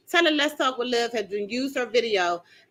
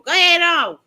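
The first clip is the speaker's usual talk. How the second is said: loud, raised call; high, loud send-off; 6 semitones higher, 8 dB louder than usual. Real, not acted. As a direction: holler it across to someone leaving. Calls out to daughter who is leaving.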